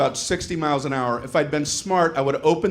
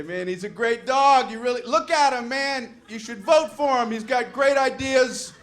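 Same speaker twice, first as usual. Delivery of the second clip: higher, overeager campaign pitch; hammed-up pitchman; 9 semitones higher than usual. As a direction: sell the dog like a desperate salesman. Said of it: "I would say".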